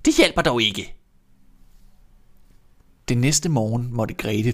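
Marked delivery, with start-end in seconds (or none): 0.0-0.9 put-on louder, scornful old witch; cold witch voice; dismissive witch. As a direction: brush her off as a witch. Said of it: "sagde den gamle".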